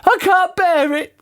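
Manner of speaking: put-on squeaky puzzled old lady; Missus Twit voice. A narrator's reading. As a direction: play her as a high, befuddled old wife.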